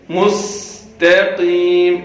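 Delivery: slow مستقیم with a light thin ta. Shown as a demonstration. Teacher modelling مستقیم. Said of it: "باریک کر کے پڑھنا ہے".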